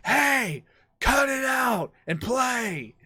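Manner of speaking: loud, high drawn-out holler; retold teacher's yell. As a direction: shout it across a noisy gym. Teacher yelling at rowdy class.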